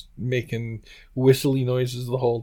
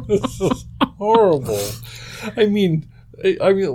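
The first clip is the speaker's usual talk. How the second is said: high-pitched, through helpless laughter; laughing; 7 semitones higher than usual. Real, not acted. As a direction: say it while cracking up. Breaking up over the botched recording.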